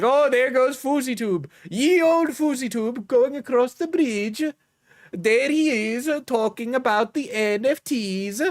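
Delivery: high, sing-song mock Swedish villager; a mock Swedish accent played for laughs. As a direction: gossip like a Swedish villager, pitched well up. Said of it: "I don't know why they're Swedish now".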